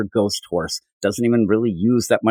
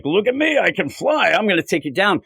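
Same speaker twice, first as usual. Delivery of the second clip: much higher, boastful and sing-song; cocky villain taunt; 8 semitones higher than usual. Retold or imitated. Podcaster mimicking Pixel's boast to the heroes, high and gloating. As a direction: gloat like a showboating brute.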